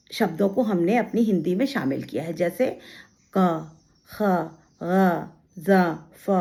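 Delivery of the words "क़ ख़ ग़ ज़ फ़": क, ख, ग as back-of-throat q, kh, gh, then z and f; teacher isolating the dotted-letter sounds; nukta sounds demo. Shown as a demonstration. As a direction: sound each borrowed letter alone, with pauses. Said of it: "हम इसको कैसे बोलते हैं".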